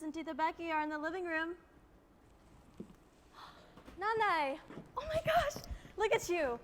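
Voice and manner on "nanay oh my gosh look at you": high, loud gasp of delighted surprise; gasp, then burst out in delight at her. Acted. Daughter sees mother dressed up.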